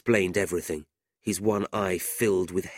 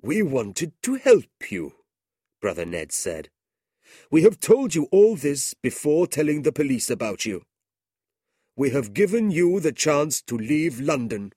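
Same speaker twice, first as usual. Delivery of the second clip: put-on gentle old brother giving Ralph his chance, raised; earnest Brother Ned; 7 semitones higher, 6 dB louder than usual. A narrator's reading. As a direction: explain the mercy, firmly. Ned explaining their mercy.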